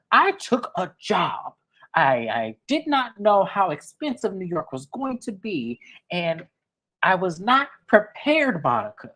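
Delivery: a hint of the mother making excuses; defensive mother. Mother voiced defending herself.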